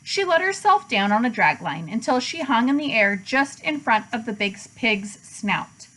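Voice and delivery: plain reading voice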